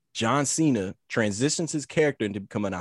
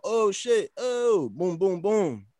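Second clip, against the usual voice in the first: excited; 8 semitones higher, much slower than usual. Retold or imitated. Retelling wrestling interference excitedly.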